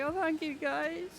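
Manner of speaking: high, overwhelmed little thanks; emotional thank you. Retold or imitated. Thanking the other team in her head, high and gushing. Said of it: "I was getting quite emotional".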